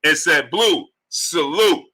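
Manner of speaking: high, slow, drawn-out announcer call; hype donation announcement. Acted. Host announcing five hundred in the super chat, drawn-out hype. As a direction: stretch each phrase, holding "hundred" long like a ring announcer.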